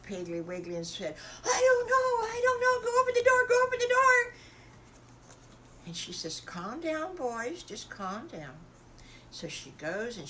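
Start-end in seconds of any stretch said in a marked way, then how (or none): 1.4-4.3 very high, loud, crying squeal; panicky piglets. Acted. Frightened piglets at knock. 6.4-8.6 higher, then slower and settling; calm the frightened children down; mother pig hushing her boys; soothing mama. Acted. Mama soothing scared piglets.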